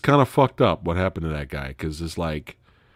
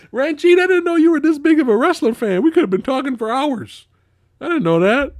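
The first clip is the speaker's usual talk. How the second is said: much higher and louder, delighted; thrilled shout-out to Ranjit; excited surprise; 6 dB louder than usual. Real, not acted. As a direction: gush at the fan in a big bright voice. Delighted reply to a chat fan.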